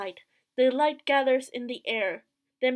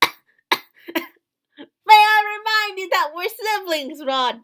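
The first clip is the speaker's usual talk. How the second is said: loud, higher scolding reminder; indignant sister; 7 semitones higher, 10 dB louder, slower than usual. Acted. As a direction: put him in his place, raised voice. Voicing sister rebuffing Rod.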